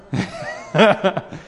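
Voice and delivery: a quick real chuckle; short laugh. Real, not acted. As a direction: laugh at your own point. Laughs at own point.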